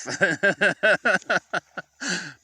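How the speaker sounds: long, high-pitched run of laughter. Real, not acted. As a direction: crack up at your own melodrama. Laughs at his own dramatics.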